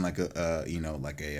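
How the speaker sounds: ordinary talk, nothing marked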